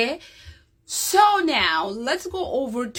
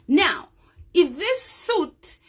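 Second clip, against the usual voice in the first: ordinary delivery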